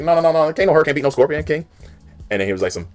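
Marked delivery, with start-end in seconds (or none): 0.0-1.7 higher, cocky Rock voice, mocking; imitating The Rock. Rock mocking Hurricane in a cocky higher voice. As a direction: hype trash talk like The Rock. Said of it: "And he was like".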